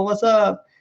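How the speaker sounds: tossing off Volo's greeting in the wrong voice; off-model Volo voice. Retold or imitated. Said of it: "That's not the voice I did for Volo at all".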